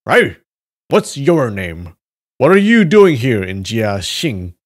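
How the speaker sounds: loud, demanding shout. Acted. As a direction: bark the question. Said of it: "The leader of the group shouted".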